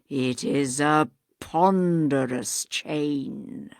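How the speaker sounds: low, slow, eerie ghostly voice; hollow ghost. Acted. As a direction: intone like a dead man's spirit.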